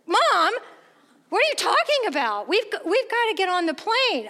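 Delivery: put-on child objecting at the gate, high and loud; protesting kid. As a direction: play the impatient kid tugging at mom.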